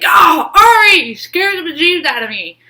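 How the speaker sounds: high, loud startled yelp; startled shout. Retold or imitated. Startled by the cat's sudden meow, loud high yelp. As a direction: jump out of your skin and shout her name. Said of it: "I was like".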